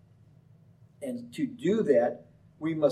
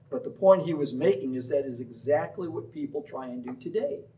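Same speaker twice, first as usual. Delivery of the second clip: ordinary talk, nothing marked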